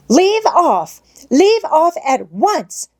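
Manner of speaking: high, shrill-pitched scream, slowing down. A narrator's reading. Governess screaming at the organ boy to stop. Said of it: "she screamed".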